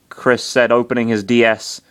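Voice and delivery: ordinary delivery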